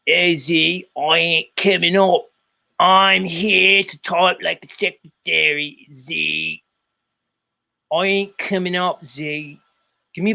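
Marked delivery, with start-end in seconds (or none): 0.1-6.6 slow, exaggerated Australian drawl; muddled Australian-New Zealand accent; Wobbly impression, Australian accent. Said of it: "I'm gonna say it like Wobbly's gonna say". 7.9-9.6 put-on Aussie accent; rough mock-Australian accent; Wobbly impression, Australian accent. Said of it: "I'm gonna say it like Wobbly's gonna say".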